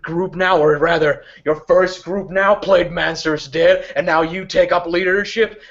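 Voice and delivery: put-on a woman screaming accusations at an old enemy; furious NPC. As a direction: rant at the phantom, rising in fury. NPC rages at Geist.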